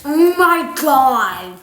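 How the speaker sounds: loud slow oh my God; amazed. Real, not acted. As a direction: blown away by the taste. First taste of lemonade strips.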